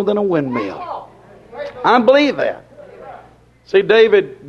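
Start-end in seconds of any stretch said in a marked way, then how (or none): none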